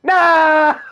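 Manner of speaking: panicked high shout. Real, not acted. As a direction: yell it out in alarm. Friend says his name.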